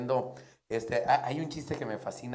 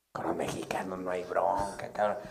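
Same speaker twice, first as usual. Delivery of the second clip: whispered aside; 5 semitones lower, 5 dB quieter than usual. Acted. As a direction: lean in and whisper it. Paisano aside.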